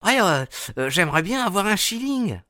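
put-on high child's voice, hesitant; a small boy thinking it over; little boy. A narrator's reading. (petit garçon, voix aiguë et hésitante)

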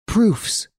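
high, breathless burst of Proofs; stammered exclamation. A narrator's reading. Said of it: "stammered Hortense".

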